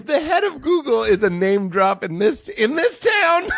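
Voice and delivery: high, incredulous shout climbing on the last words; mock outrage. Comic protests at being accused of name-dropping, mock-outraged shout. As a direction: exclaim it high, drawing out the end in mock disbelief.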